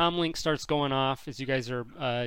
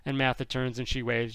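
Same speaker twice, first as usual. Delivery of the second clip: ordinary talk, nothing marked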